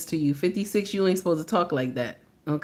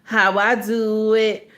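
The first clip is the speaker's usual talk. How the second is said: mocking slow, drawn-out quote; [name]; slower than usual. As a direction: stretch each word to mimic the man being criticised. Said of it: "[name] up here talking about".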